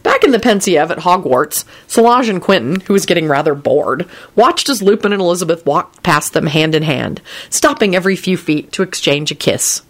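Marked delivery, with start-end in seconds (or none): none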